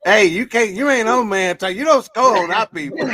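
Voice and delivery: loud, high playful scolding; mock protest. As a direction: call them out loudly in mock outrage. Cutting in on a teasing guest.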